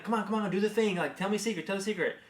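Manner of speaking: high, hurried coaxing; pleading. Retold or imitated. Dad begging daughter to do it again, pleading. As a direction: coax a reluctant child with mounting urgency. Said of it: "I was getting so frustrated".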